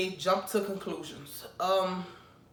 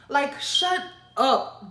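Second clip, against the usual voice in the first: annoyed; 5 semitones higher, much slower than usual. Acted. Telling off Virgos.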